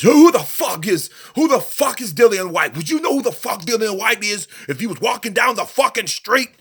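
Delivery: high, loud outraged rant; Lou DiBella going ballistic. Retold or imitated. Retelling a promoter's reaction to reporters, going ballistic. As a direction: blow up, spitting out the words in disbelief. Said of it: "that's my impersonation of Lou DiBella".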